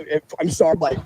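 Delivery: usual speaking voice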